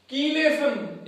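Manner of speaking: कीलेशन said with a hard "k" at the start; teacher modelling कीलेशन with a K sound; correct pronunciation. Shown as a demonstration. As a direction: say कीलेशन as "kee-LAY-shun". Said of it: "इसका सही pronunciation क्या होता है".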